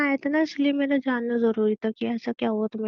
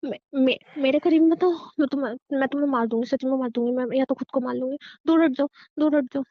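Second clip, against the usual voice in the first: घबराई धमकी. Acted. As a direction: warn them off in a frantic outburst. Episode, threatens and warns everyone off. (घबराहट में चीखकर दूर हटने की चेतावनी)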